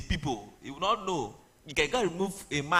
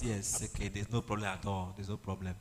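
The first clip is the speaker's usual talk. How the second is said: calm voice of God; 7 semitones lower, 7 dB quieter than usual. Acted. God answers Moses calmly.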